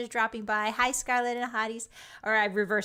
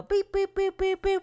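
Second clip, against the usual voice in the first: playful boops; 9 semitones higher, 3 dB louder than usual. Pretend. Scooting over on stream, high playful boops. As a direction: run of high little boops.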